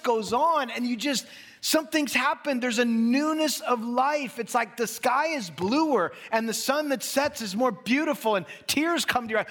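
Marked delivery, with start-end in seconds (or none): none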